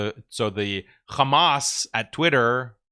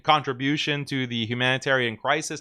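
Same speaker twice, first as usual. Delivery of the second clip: ordinary delivery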